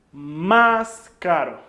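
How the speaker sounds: "más caro" slow with "más" hit loud; stress on MÁS. Shown as a demonstration. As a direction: draw out "más caro", punch "más". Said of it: "teacher drills comparative".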